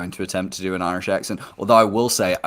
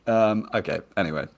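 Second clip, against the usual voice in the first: ordinary talk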